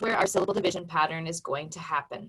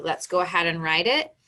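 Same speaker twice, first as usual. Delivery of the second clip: ordinary talk, nothing marked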